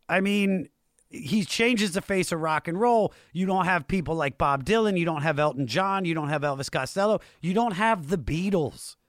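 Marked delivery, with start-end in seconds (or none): none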